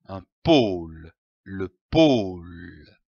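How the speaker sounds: pôle with the ô held long and slow; teacher dragging the ô in pôle; drawn-out O. Shown as a demonstration. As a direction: stretch the O of pôle as you teach it. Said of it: "On traîne le O".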